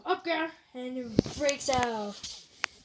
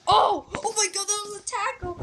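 high, loud commentator's cry; excited outburst; 7 semitones higher, 8 dB louder than usual. Real, not acted. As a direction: exclaim at a huge tackle. Reacts to a sudden tackle.

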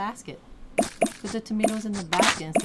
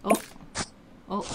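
normal talking voice

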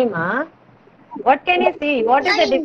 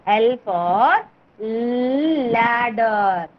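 slow demo; much slower than usual. Shown as a demonstration. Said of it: "All of you show your mouth like this".

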